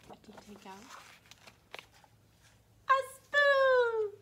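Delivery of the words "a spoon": high loud delighted; excited. Acted. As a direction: cheer the answer. Excited reveal.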